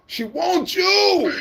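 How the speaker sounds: She wants you drawn out very slow and high, near a yell; slow high singsong taunt; high teasing call. Shown as a demonstration.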